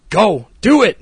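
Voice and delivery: loud, high rallying shout; cheering someone on. Retold or imitated. Husband cheering his wife on, rousing shout. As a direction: shout it like egging someone into action. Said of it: "cheering her on".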